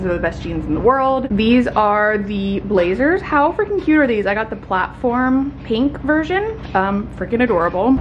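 ordinary talk

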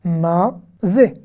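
"mazé" slowly as ma, then zé; syllable by syllable. Shown as a demonstration. Teacher breaking down a Hebrew question, syllable by syllable. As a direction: separate both syllables of "mazé". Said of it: "on va faire consonne plus voyelle".

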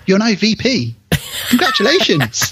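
put-on celebratory boss voice; mock congratulations. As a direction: congratulate with hammed-up cheer. Mimicking the absurd promotion.

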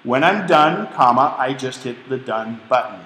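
dictation voice, punctuation spoken aloud; dictating with punctuation. Shown as a demonstration. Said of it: "keep speaking where I left off".

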